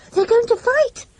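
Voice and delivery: high frightened shriek. A narrator's reading. Little girl shrieks at dogs about to fight, high and scared. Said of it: "shrieked Betts".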